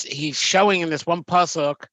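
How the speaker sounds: normal talking voice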